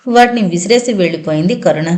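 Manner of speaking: ordinary delivery, nothing marked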